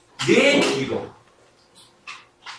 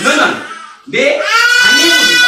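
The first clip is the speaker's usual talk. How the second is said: loud, much higher rebuke; shouting rebuke; 14 semitones higher, 6 dB louder than usual. Acted. Jesus rebuking Peter, shouting high. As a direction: hurl the words up high.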